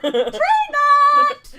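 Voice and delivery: high, loud shout for the pet; calling out. Acted. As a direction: holler the name. NPC calls for his pet.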